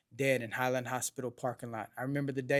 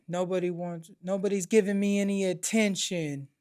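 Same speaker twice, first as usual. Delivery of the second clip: mock yell, higher and louder; moderately raised, high-pitched outburst; retold screaming; 5 semitones higher, 5 dB louder than usual. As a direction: imitate mom crying out in complaint. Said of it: "She's screaming".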